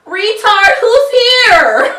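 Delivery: shrill granny yelling up the stairs at a stranger; Granny calling out. Retold or imitated. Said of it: "So Granny was like".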